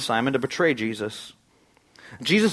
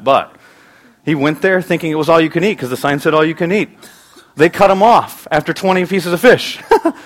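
loud, high, driving sermon voice; forceful preaching; 3 semitones higher, 8 dB louder than usual. Acted. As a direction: build it up for the congregation.

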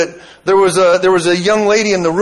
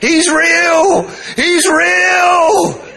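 crying out; 12 semitones higher, much slower than usual. Retold or imitated. Woman on the floor crying out that he's real. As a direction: wail it out through tears as she discovers it's true. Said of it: "She's crying".

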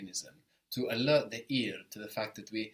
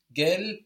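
"gel" hit loud and higher; strong, raised gel; accent on gel; 7 semitones higher, 9 dB louder than usual. Shown as a demonstration. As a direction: punch gel as the stressed syllable. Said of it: "the accent's there on the gel".

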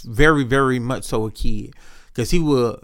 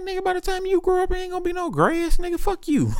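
mock high squeaky retort. Teasing a young coworker about his future, high mock squeal.

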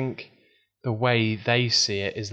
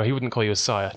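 normal talking voice

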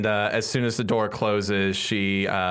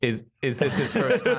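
asking through laughter; can't stop giggling while asking; laughing; 5 semitones higher than usual. Real, not acted. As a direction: giggly question. Laughing question at table.

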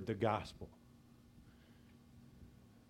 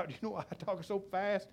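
ordinary talk

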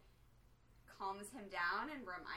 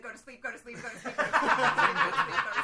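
chanted, getting louder; mock lullaby; 4 semitones higher, 18 dB louder, faster than usual. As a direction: lull a giant to sleep, hammed-up. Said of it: "be like".

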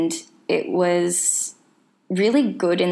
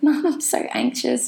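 higher-pitched mock wail to mum; retold bawling; 8 semitones higher than usual. Telling mum at the barn, half-laughing imitated bawling. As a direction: lightly imitate a tearful plea, pitched up. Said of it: "I was just bawling my eyes out".

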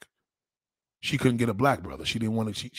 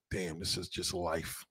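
hushed awe; 8 semitones lower, 14 dB quieter, slower than usual. Retold or imitated. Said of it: "It looks like".

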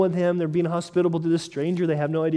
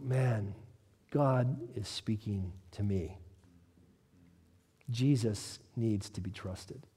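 hushed awe; 7 semitones lower, 10 dB quieter, slower than usual. Acted. Hearer moved by scripture.

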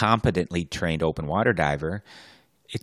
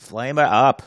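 mock Australian accent; 6 semitones higher, 4 dB louder than usual. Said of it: "Australian accents".

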